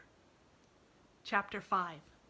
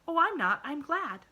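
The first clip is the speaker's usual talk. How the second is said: put-on bright, high little girl voice; eager child reassuring her friend; cheerful little girl; 11 semitones higher, 9 dB louder than usual. A narrator's reading. Pollyanna voiced in story.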